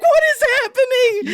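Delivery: very high, bewildered cry; baffled friend. Retold or imitated. Sam watching the scene: very high bewildered disbelief. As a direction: play Sam completely lost. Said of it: "Sam's like".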